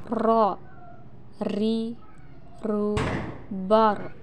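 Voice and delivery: "ro, ri, ru, bor" one at a time; tongue-tip r. Shown as a demonstration. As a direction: tap the r in each of "ro, ri, ru, bor". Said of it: "menyentuhkan lidah terdepan".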